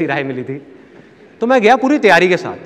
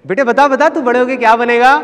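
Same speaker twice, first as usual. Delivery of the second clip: high, loud mock coaxing of a child; imitated auntie voice, pitched up; prodding relative; 7 semitones higher, 5 dB louder than usual. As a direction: egg the little boy on to answer. Relatives prompting shy child to answer.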